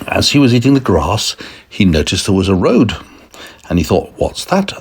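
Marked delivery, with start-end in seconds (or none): 4.2-4.7 high-pitched curious question; curious little cow's question; puzzled cow. Acted. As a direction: wonder aloud in a higher voice. Cow puzzled by unfamiliar road.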